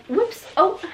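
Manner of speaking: high, dainty little mock exclamation. Showing what the fall wasn't, dainty high voice. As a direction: act out a tiny, delicate stumble. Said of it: "like, a dainty".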